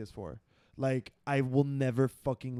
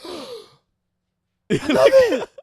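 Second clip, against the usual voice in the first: mock thrilled; 13 semitones higher, 12 dB louder, much slower than usual. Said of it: "were just like".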